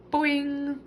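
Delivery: high, springy twanging boing; frog boing. A narrator's reading. Frog joining the talk with a high springy boing. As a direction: chime in with a bouncy frog call. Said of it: "Og chimed in".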